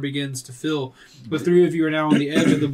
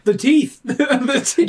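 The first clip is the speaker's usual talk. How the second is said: excited laughing shout; 9 semitones higher, 5 dB louder, slower than usual. Real, not acted. Excited shout about teeth.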